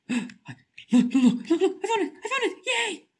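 high, giddy laughing squeal; laughing with relief and glee; excited laughing. Real, not acted. Finds the document in game.

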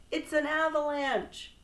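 high, loud cry of alarm. A narrator's reading. Toad warning Frog of falling stones, alarmed cry. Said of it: "cried Toad".

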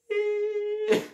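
very high sustained whine; imitated ear ringing. Movie-style aftermath of the blast, imitated ringing. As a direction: hold a thin high tone like ringing ears.

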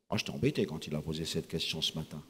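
usual speaking voice

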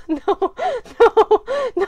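high, loud nos through laughter; laughing protest. Real, not acted. Vlogger protesting while filming, laughing loudly.

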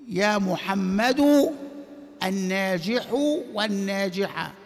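يا محمد drawn out slowly; measured classroom recitation; slow demonstration. Shown as a demonstration. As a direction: spell out the example for students. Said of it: "teacher demonstrates example slowly".

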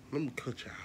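whispered aside. Real, not acted. Whispering to viewers before cutting the video. Wrapping up the recording.

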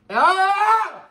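sharp high yelp of pain; pained high cry. Real, not acted.

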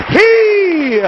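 high-pitched, repeated cry of the word; climactic preaching. Acted. Preacher exalting the one coming after John, climactic high cry. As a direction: punch each word high, pausing after it.

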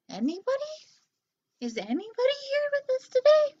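high sing-song hello, looking for anyone out there; calling out. Pretend. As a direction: call out to an empty room. Calling out to Facebook viewers.

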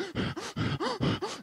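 high, quiet sobbing gasp from a little brother; imitated crying gasp. Little brother gasping between sobs as he comes home. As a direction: gulp air like a kid who's been crying. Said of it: "you don't cry like that".

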